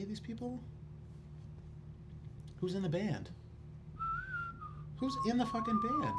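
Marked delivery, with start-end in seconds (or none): 5.0-6.1 exasperated. Real, not acted. Exasperated repeat of question.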